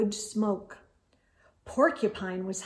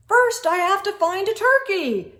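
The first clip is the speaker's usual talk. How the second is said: loud, higher call back; bellowing back; 9 semitones higher, 5 dB louder than usual. A narrator's reading. Moose answers the sheep with a loud bellow. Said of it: "Mister Moose bellowed back".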